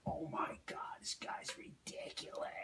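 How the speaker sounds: very soft, whispered amazement; imitated awed whisper. Audience member recalling a brilliant solo show, awed whisper.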